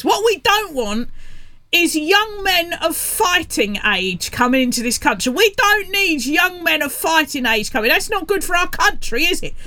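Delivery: a light Portsmouth accent, half imitated; hint of a local Pompey protester. A light Pompey accent, quoting the Portsmouth protester to listeners. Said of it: "totally local Pompey accent".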